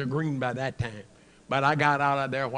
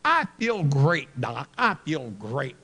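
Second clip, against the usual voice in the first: booming, slow "I feel great"; loud cocky boast; 7 dB louder, slower than usual. Retold or imitated. Patient booming back at his doctor, cocky and loud. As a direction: brush off the doctor with a loud grin.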